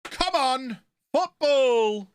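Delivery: high, loud cry of Come on, football!; impatient shout. Real, not acted. Streamer urging the football match to start, impatient shout. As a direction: yell at the game to get going.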